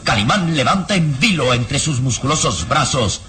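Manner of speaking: ordinary talk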